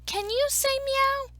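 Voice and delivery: high-pitched coaxing question. Acted. Story narrator asking the dog to meow, high coaxing. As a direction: coax it sweetly, up high.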